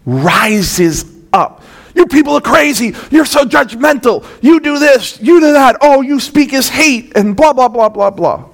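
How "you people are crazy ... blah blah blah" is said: much higher, shrill mocking rant that climbs to a shout; mock hostile listener. Preacher mimicking a friend who hates the gospel, high mocking shout. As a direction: parody the offended friend lashing back.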